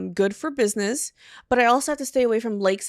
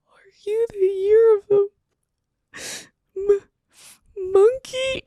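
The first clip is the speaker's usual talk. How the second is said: high nervous whisper-cry; 10 semitones higher, much slower than usual. Retold or imitated. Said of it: "And then I go".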